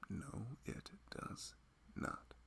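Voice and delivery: a soft, breathy whispered answer. Acted. Husband quietly answering his own question to his wife, whispered. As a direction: whisper it, barely voiced.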